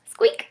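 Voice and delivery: tiny high squeak like a mouse; playful high mouse squeak. Pretend. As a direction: squeak it out like a little mouse for the kids. There's a mouse.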